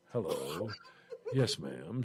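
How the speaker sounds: low, reluctant compliance; dutiful obedient reply. Retold or imitated. Meekly obeys the bossy niece.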